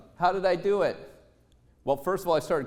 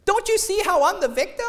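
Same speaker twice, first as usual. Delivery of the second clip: higher, louder self-pity; wife's friend whining she is the victim, pitched higher; whiny friend; 11 semitones higher, 4 dB louder than usual. Retold or imitated. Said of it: "Five minutes later".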